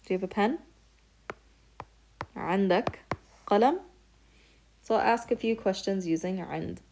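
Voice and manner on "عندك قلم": عندك said level, then قلم jumps up sharply; question rise. Shown as a demonstration. Teacher to students, a sharp questioning jump on قلم. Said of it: "Just ask it with inflection".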